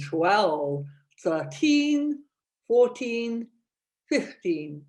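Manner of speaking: loud counting through a cork; cork in mouth. Shown as a demonstration. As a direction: count to fifteen biting a cork. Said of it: "So, cork in the mouth".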